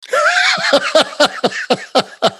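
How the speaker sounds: a high burst of laughter. Real, not acted. Laughing after Matt quote.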